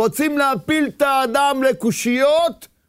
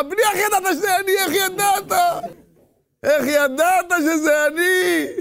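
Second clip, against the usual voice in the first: surprised father; 6 semitones higher than usual. Acted. Father answers son in parable.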